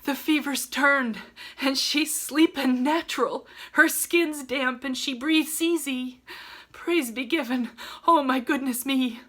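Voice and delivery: put-on old nurse's exclamation. A narrator's reading. Faithful nurse Hannah rejoicing over Beth's recovery, high excited exclaim. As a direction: blurt out the good news, overcome. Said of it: "exclaiming under her breath".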